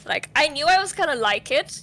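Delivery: usual speaking voice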